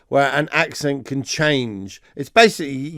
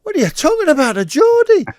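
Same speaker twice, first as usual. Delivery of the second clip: much higher, offended mock protest; indignant Middlesbrough local; 7 semitones higher than usual. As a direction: play the outraged local who's no Geordie. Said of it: "And they say".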